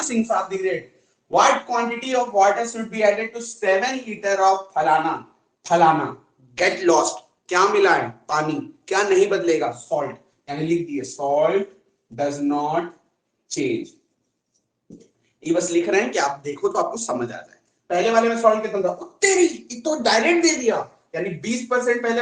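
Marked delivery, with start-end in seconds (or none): 1.3-6.2 slow, deliberate reading of the question; measured classroom read-aloud; reading out slowly. Shown as a demonstration. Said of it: "reads out question slowly". 18.9-20.9 much higher, a sudden "oh wow"; playful teacher surprise; surprised. Real, not acted. Surprised at student's direct answer.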